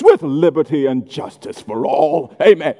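a touch of mock reverence; mock prayer voice. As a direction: lightly parody a pompous pray-er closing a prayer. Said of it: "like, a prayer voice".

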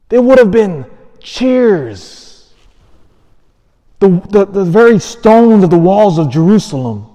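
loud, high-pitched pulpit proclamation; ringing voice, punching out the key words; impassioned preaching. Real, not acted. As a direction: preach at full force to a big congregation. Preacher rising to full force.

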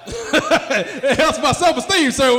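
high, loud, slow repeat through laughter; laughing shout. Real, not acted. Comic repeating request to laughing audience member, laughing shout. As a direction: shout it slowly while laughing.

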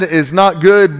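normal talking voice

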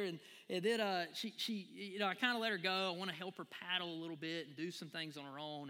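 ordinary talk, nothing marked

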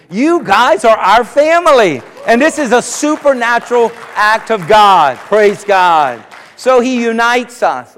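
rising preacher's cadence, building in force; preaching. Acted. Pastor to his congregation, preaching with rising fervor. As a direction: preach it out to the pews.

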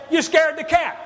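loud, high angry shout; yelling. Retold or imitated. Husband shouting at his wife in the garage, loud and high. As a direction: yell it at her, blaming. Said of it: "I yell at her".

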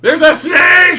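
high, loud yelp of discovery; comic alarmed holler; excited shout. Acted. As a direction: burst in yelling over the talk. Calls out a snake on screen.

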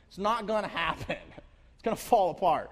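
loud mock panic; mock despair. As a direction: overdo the hopeless panic.

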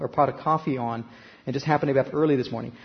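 ordinary talk, nothing marked

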